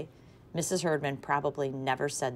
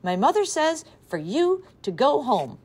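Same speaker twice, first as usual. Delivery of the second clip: higher, louder call from the porch; yelled; 10 semitones higher, 6 dB louder than usual. A narrator's reading. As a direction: call out across the yard. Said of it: "stood on her front porch and yelled".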